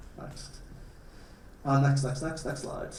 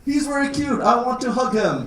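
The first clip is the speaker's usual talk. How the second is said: gushing; 8 semitones higher, 3 dB louder than usual. Real, not acted. Cooing over a bear.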